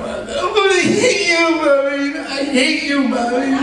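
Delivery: high, drawn-out mock crying wail; talking while crying. Child just hit by grandmother, hammed-up talking through tears. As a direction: wail the words like a tearful, punished child. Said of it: "talking and crying at the same time".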